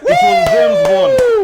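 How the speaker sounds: high, loud, slow triumphant shout; triumphant reveal. Real, not acted. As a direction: announce the answer in triumph. Triumphant quiz answer reveal.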